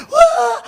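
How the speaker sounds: very high mock crying wah; imitated child wail. Father imitating son's crying, high mock wail. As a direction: wail like a small hurt child.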